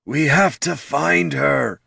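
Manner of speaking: high, slow, worried plea; fretting troll. A narrator's reading. Troll anxious for the missing ranger, slow fretting plea. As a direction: fret aloud in a drawn-out troll voice. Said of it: "fretted the troll".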